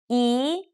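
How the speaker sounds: "E" rising; second tone E. Shown as a demonstration. Teacher demonstrates rising tone on E. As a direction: lift "E" upward. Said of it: "E, sounds like with four different tones".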